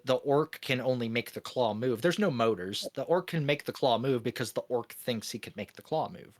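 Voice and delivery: normal talking voice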